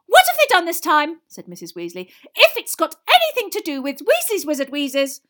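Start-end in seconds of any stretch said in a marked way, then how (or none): 0.1-1.2 high, very loud exasperated question; angry demand. A narrator's reading. Mother demands news of her sons' mischief, angry demand. 2.4-5.2 high, loud tirade; angry warning. A narrator's reading. Mother warns about the twins' joke business, angry warning.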